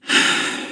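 soft resigned sigh. A narrator's reading. Jonas giving up on explaining, sighing. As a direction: let out a quiet breath of giving up. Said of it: "Jonas sighed".

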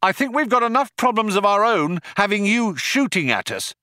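high-pitched call across to attackers; Ford shouting out. A narrator's reading. Ford yells back at the cops shooting at them, shouting out. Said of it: "Ford shouted out".

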